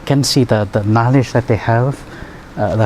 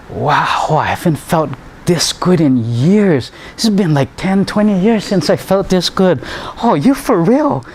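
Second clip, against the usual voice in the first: higher, rising into wonder; impersonating the patient's amazement, climbing higher; amazed patient; 7 semitones higher than usual. Retold or imitated. Patient marvels at feeling good again, higher and amazed. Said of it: "and he goes".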